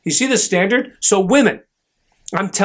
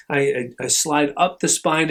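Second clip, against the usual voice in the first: ordinary talk, nothing marked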